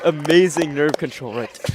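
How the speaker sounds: loud, high hyped praise; esports caster. Real, not acted. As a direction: hype the winning solve. Reacting to the winning solve.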